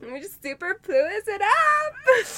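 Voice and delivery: high, slow, sing-song; hyping up a party; playful cheer. Pretend. Joking party chant.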